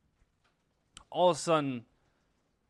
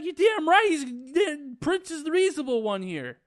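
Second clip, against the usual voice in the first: high, loud indignant agreement; emphatic outburst; 12 semitones higher, 6 dB louder than usual. Acted. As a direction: burst out high in mock indignation. Reviewer loudly sides with Prince.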